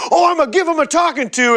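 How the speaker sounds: high, fast mock outrage; put-on indignation. Band member plays along with the angry club guy, put-on indignation. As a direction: act outraged for the angry guy's benefit. Adam pretends to side with guy.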